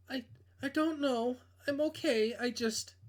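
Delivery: a hint of a nervous young woman; girl voice. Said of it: "I can't do girl voices".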